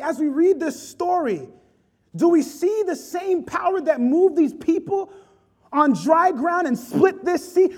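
rising, loud pulpit preaching, pressing each phrase; preaching at full force. Acted. Preacher urging the congregation to see God's power, loud and fervent. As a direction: build the sermon to a passionate peak.

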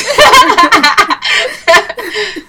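high burst of laughter; loud laughter. Real, not acted. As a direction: crack up laughing. Laughs thanking Alexis.

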